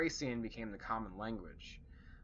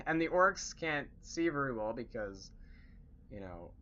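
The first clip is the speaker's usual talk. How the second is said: normal talking voice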